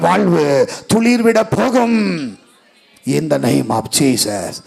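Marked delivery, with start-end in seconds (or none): 3.1-4.5 hushed, unhurried amen; solemn slow invocation. Real, not acted. A preacher sealing a prayer over the congregation, low and very slow. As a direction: drop much lower and stretch every word out slowly.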